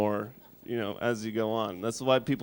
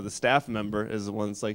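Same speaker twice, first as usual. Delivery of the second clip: ordinary talk, nothing marked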